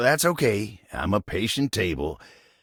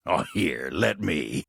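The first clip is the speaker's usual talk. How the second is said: usual speaking voice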